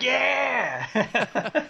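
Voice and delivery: high, drawn-out cheering "yeah"; mock egging on. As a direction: imitate a crowd cheering on a daredevil. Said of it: "egging the guy on".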